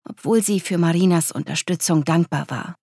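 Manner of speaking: usual speaking voice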